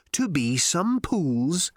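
like a patient accounts clerk explaining; slow, distinct, patient. A narrator's reading. Ford explaining to a baffled Arthur, slowly and patiently. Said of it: "as slowly and distinctly and patiently".

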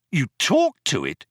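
can't believe what he's hearing; exclaimed. A narrator's reading. Ford astonished that Marvin talked to the ship, incredulous. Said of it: "exclaimed Ford".

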